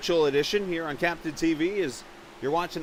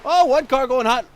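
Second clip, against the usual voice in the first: excited call; 8 semitones higher, 9 dB louder than usual. Real, not acted. Car enters pit too fast.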